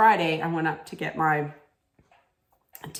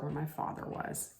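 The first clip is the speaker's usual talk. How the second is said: barely voiced, much softer; whispered grief; 15 dB quieter than usual. Real, not acted. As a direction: let it drop to a whisper of real grief. Telling of father's death.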